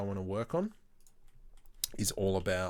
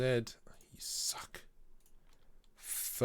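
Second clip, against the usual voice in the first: unvoiced little jab under the breath; whispered grumble; 2 semitones higher, 6 dB quieter, much slower than usual. Real, not acted. Streamer grumbling at uncooperative modelling work, whispered. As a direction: whisper it crossly to yourself. Muttering at the software.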